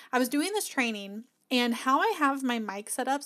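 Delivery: ordinary delivery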